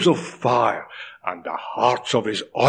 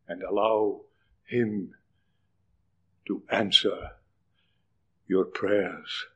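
soft close; 5 semitones lower, 9 dB quieter than usual. Real, not acted. Sermon drops after loud plea.

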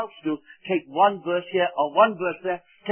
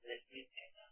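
hushed whisper; 27 dB quieter than usual. Real, not acted. Grim hushed words about tyrant.

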